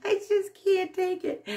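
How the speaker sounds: high squeaky overjoyed voice; can't contain the joy, voice jumping up; overwhelmed squeal. Real, not acted. Vlogger to viewers, squealing with joy. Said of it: "how excited I am".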